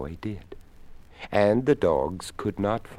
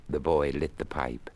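plain reading voice

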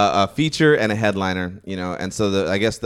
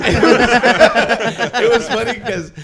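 high, loud laughing speech; laughing hard; 12 semitones higher, 10 dB louder, much slower than usual. Real, not acted. Comic laughing hard while starting his story, high loud laugh. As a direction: push the words through a big laugh.

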